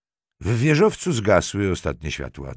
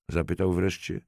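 ordinary delivery

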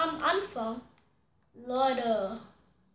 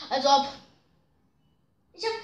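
usual speaking voice

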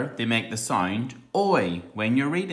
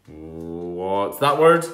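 slow teasing question; 5 semitones lower, much slower than usual. Pretend. Teacher teases slowly.

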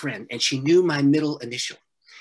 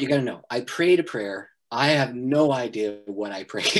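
normal talking voice

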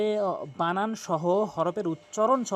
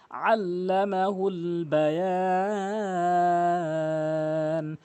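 slow, drawn-out melodic tajweed chant; Quran recitation; much slower than usual. Acted. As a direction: recite each word long and sung. Recites the verse.

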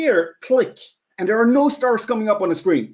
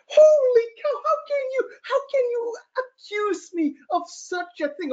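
hammed-up sob, much higher, wailing; mock crying. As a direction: fake tears of wounded innocence, squeaky high. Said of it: "I'm like".

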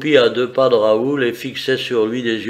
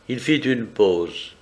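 plain reading voice

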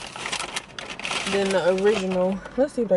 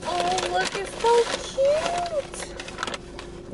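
high cooing over something cute; much slower than usual. Real, not acted. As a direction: squeal at how cute it is. Cooing over the small burger.

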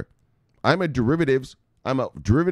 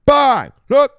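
a sudden yelled bang, much higher and louder; loud bang shout; 12 dB louder than usual. Real, not acted. Ranting trader slams the market: explosive shout. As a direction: slam it home with one shouted word. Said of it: "let me bang this".

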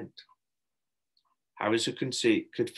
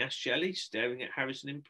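ordinary delivery, nothing marked

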